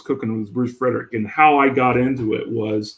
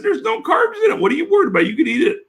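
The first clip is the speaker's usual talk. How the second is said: indignant; much faster than usual. Retold or imitated. Retelling his own indignant reply.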